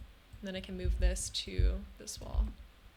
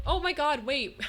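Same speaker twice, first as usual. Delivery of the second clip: surprised; 6 semitones higher, 6 dB louder than usual. Real, not acted. Sudden realization of forgotten dresser.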